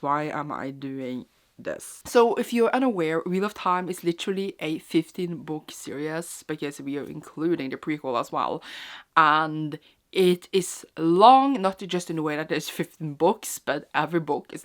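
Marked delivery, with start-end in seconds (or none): 0.0-2.0 slow, soft mock-despairing repeat. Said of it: "And I am like". 9.2-11.6 slow, loud drawn-out emphasis on long. Real, not acted. Stressing how long the series is.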